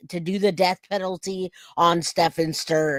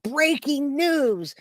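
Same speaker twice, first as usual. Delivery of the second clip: Nancy Grace; 8 semitones higher, much slower than usual. Retold or imitated. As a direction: imitate the dramatic anchor. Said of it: "she said".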